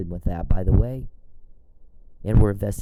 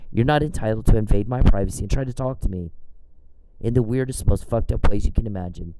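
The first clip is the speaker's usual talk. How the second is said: normal talking voice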